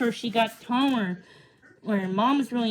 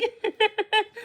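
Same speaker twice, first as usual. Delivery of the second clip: a quick high laugh; high burst of laughter; 11 semitones higher than usual. Real, not acted. Narrator laughing after line.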